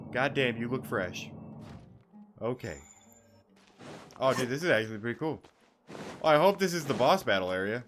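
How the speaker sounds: usual speaking voice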